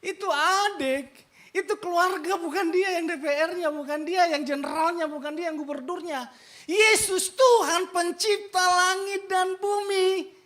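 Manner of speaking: loud, high sermon delivery; preacher thundering at the congregation; fiery preaching. Acted. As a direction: drive it home to the congregation. Preaching at full force.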